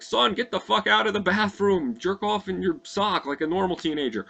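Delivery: high, loud dad yelling through a door; mock-yelled through the bathroom door. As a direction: bang on the door and yell at your son. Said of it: "And he's like".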